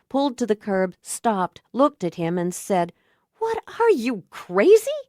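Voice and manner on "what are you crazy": incredulous. Acted. She stops car, confronts him.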